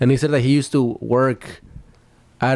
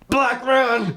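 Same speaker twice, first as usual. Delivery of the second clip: blurted quote; 11 semitones higher than usual. Pretend.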